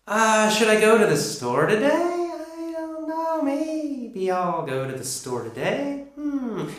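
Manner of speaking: high, drawn-out hesitant musing with rising ends; unsure, rising. Shown as a demonstration. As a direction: wonder aloud, slowly, pitch lifting like a question. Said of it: "If we sound unsure, we're not quite sure, then we usually go up".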